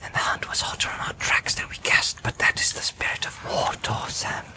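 hushed Frodo whisper. Acted. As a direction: whisper urgently while hiding. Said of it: "Frodo whispered".

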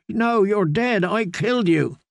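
high, shaken disbelief; horrified. A narrator's reading. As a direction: deny it in horror. Horrified denial in fever.

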